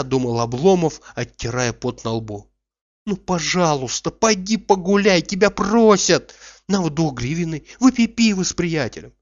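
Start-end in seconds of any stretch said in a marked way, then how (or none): 3.1-9.1 higher-pitched coaxing; persuasive, raised-pitch urging; pleading. A narrator's reading. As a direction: coax him out, pressing gently but insistently. Master begs servant to go out.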